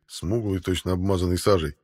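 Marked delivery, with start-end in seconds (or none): none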